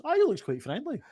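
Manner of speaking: naive mouse voice. Acted. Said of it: "a mouse ... goes".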